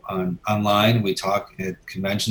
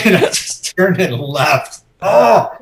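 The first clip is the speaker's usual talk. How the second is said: laughing embarrassment; 8 semitones higher, 6 dB louder, slower than usual. Real, not acted. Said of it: "so mortified".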